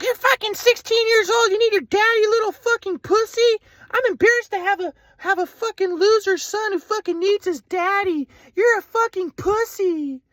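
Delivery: high, slow sneering mother's voice; mocking mom. Mom insulting her teenage son, high sneering voice.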